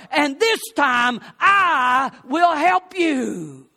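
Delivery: slow, drawn-out comfort rising to a loud high cry; tender father. Retold or imitated. Father comforting his crying son, slow and swelling. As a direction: hold him close and promise help, slowly.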